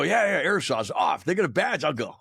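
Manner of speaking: high, excited re-enactment of jumping at an offer; retold eagerness. Grunt sold on the hundred first, retold eagerness. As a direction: act out being instantly sold.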